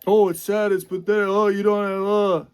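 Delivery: nasal-free sing-song mockery of critics; mocking whine.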